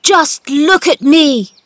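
high, loud, slow demand; proud insistent yell; triumphant shout. A narrator's reading. Boy showing the gardener he stands, shouting. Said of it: "he shouted up at Ben".

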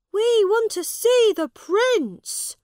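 high, loud shout; crowd shout. A narrator's reading. People waiting to see the prince, shouting. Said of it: "the people shouted".

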